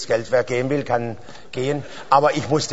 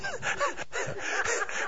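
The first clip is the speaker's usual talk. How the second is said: breathy chuckle mid-story; soft wheezy laugh; 11 dB quieter than usual. Real, not acted. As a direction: laugh quietly at your own anecdote. Laughs while telling anecdote.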